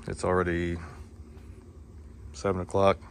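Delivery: ordinary talk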